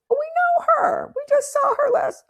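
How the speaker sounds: very high, loud squeal; squealing strangers. Retold or imitated. Strangers at the drum circle who know her client, squealing high.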